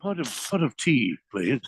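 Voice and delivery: a hammed-up posh British accent; joking British accent at the fondue table; mock British accent. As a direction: play a toff Englishman for laughs. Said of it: "Did you say pot of cheese".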